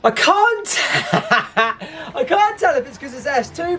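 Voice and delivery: real laughter breaking into the line; laughing. Real, not acted. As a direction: giggle helplessly at your own driving. Laughing at own driving.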